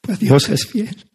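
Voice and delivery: real emotion, high strained voice breaking through; choked up. Real, not acted. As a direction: overcome while giving thanks, pushing the words out high and loud. Overcome with emotion after long pauses.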